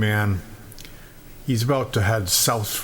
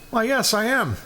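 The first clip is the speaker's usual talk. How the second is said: higher-pitched dog character, lightly voiced; talking dog; 10 semitones higher than usual. Dog answers the visitor, lightly voiced in higher pitch. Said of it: "The dog looks him in the eye and says".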